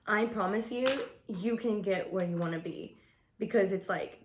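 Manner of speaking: ordinary talk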